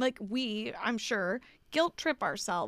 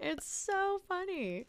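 laughing; 7 semitones higher, 4 dB quieter, much slower than usual. Real, not acted. Laughing after joke.

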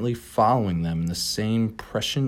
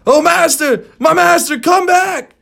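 calling out; 12 dB louder than usual. Acted. Servant calling captain back.

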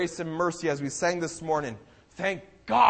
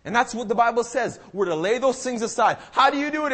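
ordinary delivery

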